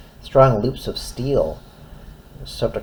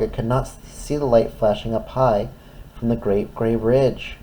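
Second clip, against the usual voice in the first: usual speaking voice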